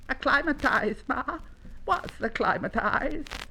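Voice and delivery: high little voice, slowing into the question; puzzled young llama. Acted. Young llama asks his Ma about a new word, puzzled. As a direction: ask in a high childlike voice.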